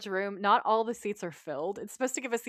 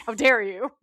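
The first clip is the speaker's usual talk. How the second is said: mock indignation; 7 dB louder than usual. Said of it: "Dean looks pissed off".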